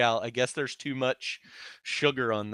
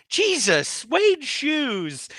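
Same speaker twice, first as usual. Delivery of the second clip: higher, louder mock outburst at the shoes; disgusted exclamation; 13 semitones higher, 3 dB louder, much slower than usual. Friend quoting a movie cop reacting to a smelly shoe, mock disgusted yelp. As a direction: squeal it like the smell just hit you. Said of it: "and he's like".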